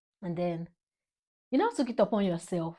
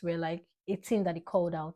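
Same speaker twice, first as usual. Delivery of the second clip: ordinary talk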